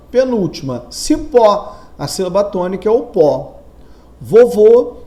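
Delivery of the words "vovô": "Vovô" loud, weight on the last syllable; stress on final syllable. Shown as a demonstration. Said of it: "a sílaba tônica é o vô".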